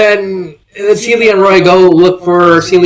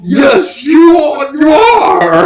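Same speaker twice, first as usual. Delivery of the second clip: builds into gleeful joy; overjoyed Bowser; 11 semitones higher, slower than usual. Retold or imitated.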